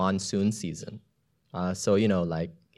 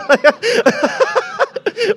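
loud high laugh; 9 dB louder than usual. Real, not acted. Laughing at own panic voice.

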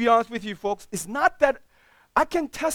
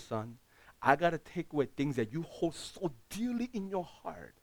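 voice of God; 6 semitones lower, 8 dB quieter than usual. Retold or imitated. Pastor voicing God speaking softly to him. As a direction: speak softly as a patient heavenly father. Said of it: "He said".

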